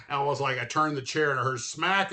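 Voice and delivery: ordinary delivery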